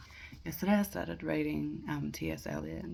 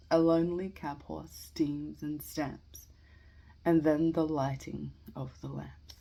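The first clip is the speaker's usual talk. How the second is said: measured verse reading with pauses between lines; poetry reading; 5 dB louder than usual. Acted. Amateur reading Eliot's Preludes to listeners, measured poetry reading. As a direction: read each line out deliberately, then pause. Reads a poem aloud.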